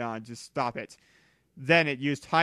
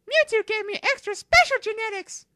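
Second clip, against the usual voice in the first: very high, squeaky little creature voice; a tiny creature piping up in a high squeak; Umbreon voice; 7 dB louder than usual. Acted. Umbreon explains to Mew three in a high squeaky voice.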